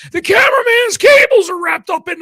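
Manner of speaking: high-pitched panicked scream. Retold or imitated. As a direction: shriek it out in a panic. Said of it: "To Shivani screaming".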